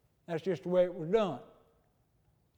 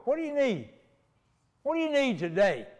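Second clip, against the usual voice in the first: asks it again higher for the one who missed it; higher, repeated question; 4 semitones higher than usual. Shown as a demonstration. Preacher repeating his question to the congregation, raised. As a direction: repeat the question up high and clear. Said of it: "Do what?".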